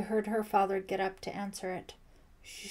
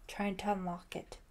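ordinary delivery, nothing marked

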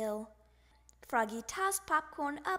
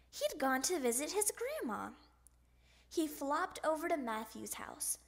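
ordinary delivery

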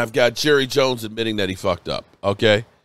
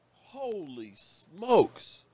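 slow, much softer, awestruck breath of disbelief; stunned hush; 2 semitones higher, 13 dB quieter, much slower than usual. Real, not acted. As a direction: let it fall away almost to nothing. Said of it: "I'm sorry if I'm a little emotional".

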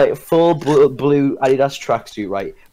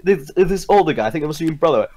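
ordinary delivery

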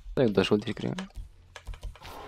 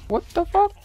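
shocked. Real, not acted. Sudden shock in game.

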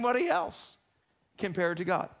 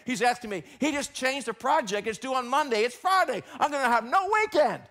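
whining complaint; 5 semitones higher, 4 dB louder than usual. Acted. Voicing complaining employee.

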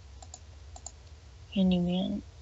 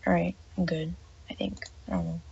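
ordinary talk

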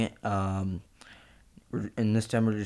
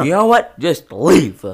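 loud, much higher yell; furious shout; 8 semitones higher, 13 dB louder, slower than usual. Acted. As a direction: scream him out the door. Shouting match.

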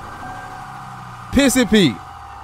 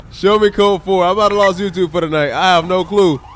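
ordinary talk, nothing marked

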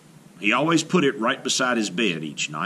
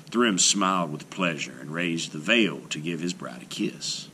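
ordinary delivery

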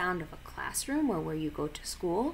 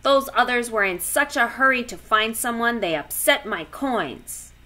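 loud, fast grumpy lobster; lobster voice with claw clacks; 4 semitones higher, 7 dB louder than usual. A narrator's reading. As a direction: grumble like an annoyed lobster. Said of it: "the lobster grumbled".

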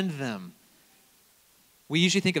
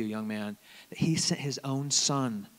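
ordinary delivery

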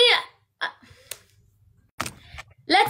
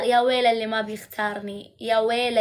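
mock threat; 6 semitones lower, 3 dB quieter, faster than usual. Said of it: "استخدمت الفلتر".

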